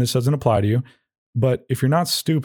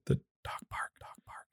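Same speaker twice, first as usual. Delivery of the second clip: whispered so the dogs can't hear; 17 dB quieter than usual. Shown as a demonstration. Owner whispering so dogs don't hear, whisper. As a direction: breathe it out unvoiced. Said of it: "I'm gonna start saying the place 'cause that's what I do at home".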